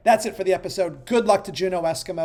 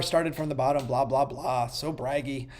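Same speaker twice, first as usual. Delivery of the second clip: mock-dismissive mumble; 7 semitones lower, 10 dB quieter than usual. Real, not acted. Mocking the top team.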